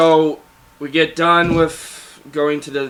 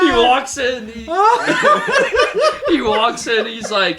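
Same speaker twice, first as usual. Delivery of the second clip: words pushed through high laughter; can barely get it out for laughing; laughing while telling; 10 semitones higher, 4 dB louder than usual. Real, not acted. Telling friend the punchline, laughing.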